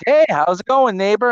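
high, loud hello to a neighbour; mock neighbourly greeting. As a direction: call out an overly friendly greeting. Pretend greeting to passer-by while peeing.

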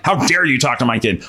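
normal talking voice

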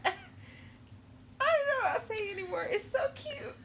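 high, flustered laughing apology; giggling. Real, not acted. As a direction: laugh through the embarrassment.

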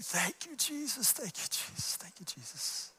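soft broken thank you, trailing off; a light imitation of tearful praise. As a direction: echo a dying man's weak gratitude. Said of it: "He's crying".

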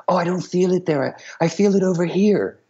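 high, light-hearted imitation of a pop star; imitated high voice of a musical collaborator. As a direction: voice his objection up high. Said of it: "and he would say".

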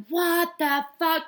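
high, slow, drawn-out shocked gasp of the words; shocked girls. Retold or imitated. Girls in the changing room reacting, stretched and shocked. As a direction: stretch it out like you can't believe it.

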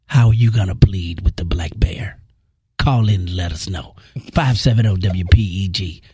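mock-dramatic announcer. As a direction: intone it deep and deliberate, like a trailer.

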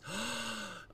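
put-on high, excited little-girl gasp; imitated child gasp. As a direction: gasp like a kid spotting something amazing. Child sees bird, gasps.

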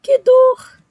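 high, slow whimpering moans of pain. A narrator's reading. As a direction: whine and sob over a sore jaw. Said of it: "E ele ficava choramingando".

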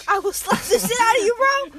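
playful threatening yell; loud jokey threat at a coworker; loud mock threat.

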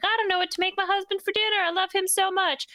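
high, fast, sugary fake-innocent voice; sweet innocent wife. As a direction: play the sweet housewife covering up a murder. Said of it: "And she's like".

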